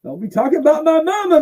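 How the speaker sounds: high, outraged mock Mean Gene; indignant Mean Gene. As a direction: squeak it out like an offended old announcer. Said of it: "Mean Gene said".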